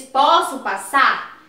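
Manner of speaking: slow, firm direct question. Shown as a demonstration. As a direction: ask it straight out, each word clear. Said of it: "É uma pergunta".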